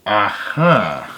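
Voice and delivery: ordinary delivery, nothing marked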